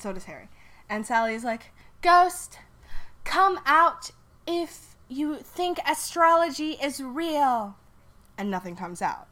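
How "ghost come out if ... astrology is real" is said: high, slow, loud medium call; high, slow medium voice calling the ghost; summoning the ghost. Acted. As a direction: call into the dark like a seance medium.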